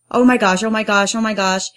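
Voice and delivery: mild retold panic; freaking out. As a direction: repeat it in a small flurry of acted panic. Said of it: "I was freaking out".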